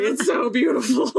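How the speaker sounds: high, laughing half-sob of joy; mock blubbering. Reliving the Little Mermaid ride, laugh-crying with joy. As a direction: gush through happy tears, half laughing. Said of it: "I'm just like".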